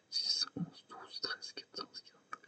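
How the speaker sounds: whispered counting under breath; whispering. Real, not acted.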